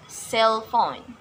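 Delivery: cell phone with CELL stressed; stress on first word. Shown as a demonstration. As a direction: lean on CELL, let phone fall. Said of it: "stress lies in the first word".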